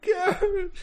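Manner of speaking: high little sobbed word; Teddy crying. Acted. Teddy repeating his mom's name through tears, crying. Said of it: "He's crying".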